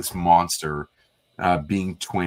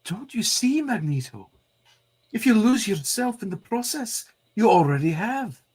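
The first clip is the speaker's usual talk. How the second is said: put-on much higher imploring Scottish accent; pleading Scottish accent; 14 semitones higher than usual. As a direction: beg him in a Scottish accent. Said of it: "the compliment on the Scottish accent".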